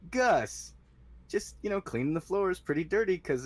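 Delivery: put-on high-pitched old janitor, putting on innocence; old man Gus. Old mopper explaining himself to a guard, high-pitched. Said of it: "because I'm old".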